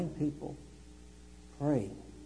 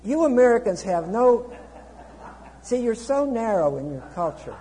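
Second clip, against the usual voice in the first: higher and much louder, lecturing tourists; mock snooty scolding; 8 semitones higher, 11 dB louder than usual. Preacher mocks a snooty local scolding narrow Americans.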